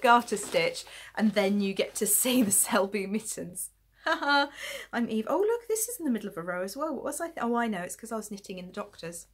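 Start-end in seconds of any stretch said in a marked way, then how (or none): none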